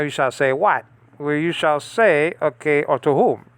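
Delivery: ordinary delivery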